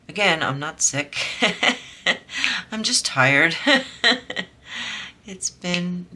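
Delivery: chuckling between words; laughing. Real, not acted. Laughing off being tired.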